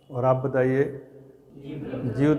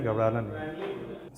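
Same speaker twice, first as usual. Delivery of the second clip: much softer and slower, gently calming; soft reassurance; 4 semitones lower, 10 dB quieter, slower than usual. Real, not acted. Teacher reassuring a nervous student, softly and slowly. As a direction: ease a nervous student's fear.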